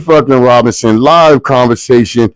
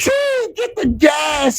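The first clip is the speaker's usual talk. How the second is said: hype shout; 12 semitones higher, 9 dB quieter, much slower than usual. Real, not acted. Host hypes up his guest with a high shout. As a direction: yell it like a crowd hype man.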